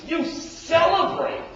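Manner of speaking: high, slow, loud outrage; older son accusing father; indignant. Acted. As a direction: stretch the word in disbelief. Son's indignant peak.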